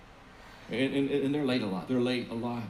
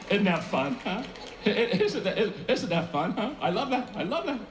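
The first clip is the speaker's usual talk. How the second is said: laughing; 8 semitones higher, slower than usual. Real, not acted. Laughing at own joke.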